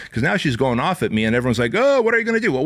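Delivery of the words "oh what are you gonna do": much higher, jeering "oh" up top; mock taunting crowd. Bar crowd goading the bouncer, high jeering mockery. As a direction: mimic the crowd's sing-song taunt.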